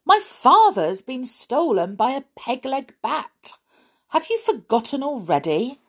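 high-pitched, indignant protest. A narrator's reading. Mouse reminding the doctor her father was stolen, indignant. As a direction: protest loudly and high, affronted. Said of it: "Olivia repeated indignantly".

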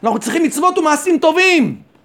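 high-pitched insistent protest; imitating an urgent no; acted refusal. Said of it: "והם משובים".

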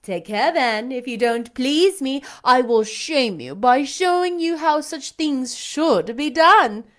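haughty lady commanding a singer; imperious belle. A narrator's reading. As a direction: order him about like a mock queen. Blanche threatening playfully.

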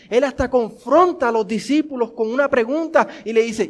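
ordinary talk

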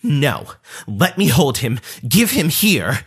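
insistent, rising cousin voice, a bit slow; pushy cousin. Retold or imitated. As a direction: demand the baby, getting pushier. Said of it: "gets pretty aggressive at this point".

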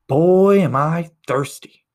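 high, loud, drawn-out moan to nobody; loud whiny complaint. Retold or imitated. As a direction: announce your thirst to the whole carriage. Stranger calls out on train.

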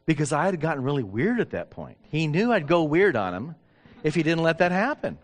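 usual speaking voice

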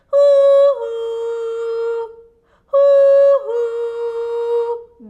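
loud, sung-out hooting; both call together for the lost chick; loud owl hoots. A narrator's reading. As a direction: hoot together as loud as you can. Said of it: "diye bağırdılar".

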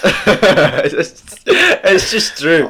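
slow, loud words through laughter; laughing. Real, not acted. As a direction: laugh it out slowly. Laughing while talking.

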